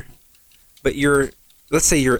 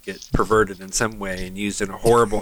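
normal talking voice

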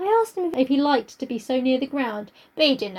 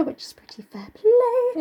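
higher, slower whisper; whispered aside; 11 semitones higher, slower than usual. Real, not acted. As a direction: slip the remark in a whisper.

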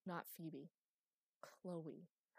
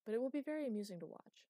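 quick, high and amused; 6 semitones higher, 8 dB louder, faster than usual. A narrator's reading. Master teasing Chloe while leaving, amused. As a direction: toss it off briskly, with amusement. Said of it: "Master chuckled".

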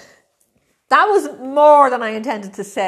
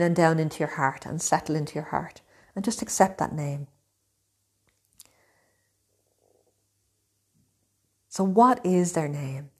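right in your ear. Acted. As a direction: guide them calmly, close to the mic. Leads a guided meditation.

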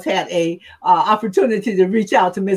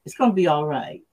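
soothing reassurance; 6 semitones lower, 5 dB quieter than usual. Shown as a demonstration. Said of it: "I can't reproduce Miss Ticola's voice".

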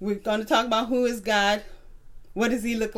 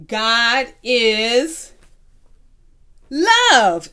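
"God is" then a loud, ringing "love"; say it together; 6 dB louder, much slower than usual. Shown as a demonstration. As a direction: land "love" loud for the class to echo. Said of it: "Say it with me".

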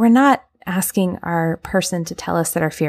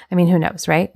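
ordinary talk, nothing marked

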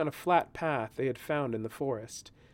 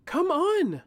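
high, loud urgent snap; sharp hiss; 9 dB louder than usual. Acted. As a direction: snap at them to hurry. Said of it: "Talia hissed".

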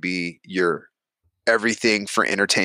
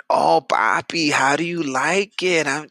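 high, slow put-on woman's voice; mock sultry woman; 4 semitones higher, slower than usual.

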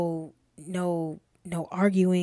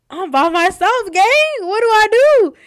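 mock panic, higher and much louder; panicked yell; 13 semitones higher, 13 dB louder than usual. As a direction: shout it like a lost kid alone in the dorm. Panicking alone after mom left.

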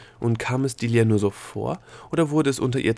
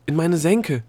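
loud, high panicked call; alarmed cry; 6 semitones higher, 6 dB louder, slower than usual. A narrator's reading. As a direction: call out in dismay while sinking. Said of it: "rief sie zu Opal".

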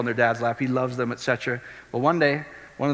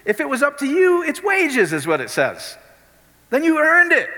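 full-force preaching; 13 semitones higher than usual. Acted. Preacher pressing the good news on the crowd, full force. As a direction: drive the gospel home to the crowd.

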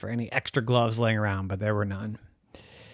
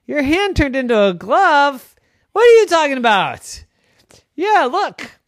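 high, loud disbelief; mother shocked at her son's hand, high and loud; astonished mom; 13 semitones higher, 9 dB louder than usual. Acted. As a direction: mom can't believe it.

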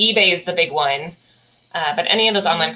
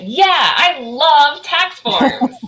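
very slow, high, drawn-out mock enthusiasm; sarcastic; 6 semitones higher, 4 dB louder, much slower than usual. Shown as a demonstration. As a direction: stretch YEAH and LOVE high, with heavy sarcasm. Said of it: "I wonder if he's been sarcastic".

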